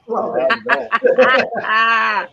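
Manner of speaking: slow, drawn-out Arnold impression; Arnold Schwarzenegger impression. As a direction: stretch the name out like Arnold. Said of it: "That's hilarious".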